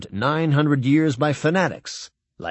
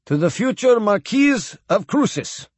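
put-on formal toast in a light Russian accent; Russian admiral accent; 8 semitones higher than usual. A narrator's reading.

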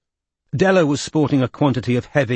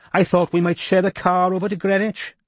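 higher, gracious Welsh woman's voice; poised Welsh lady; 9 semitones higher than usual. A narrator's reading. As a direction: speak with calm authority, Welsh lilt. Della voiced in story.

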